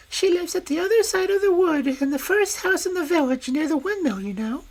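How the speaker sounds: high, quick and innocent; little girl eagerly telling the wolf her errand. Acted. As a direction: chatter like a trusting child. Girl character voice.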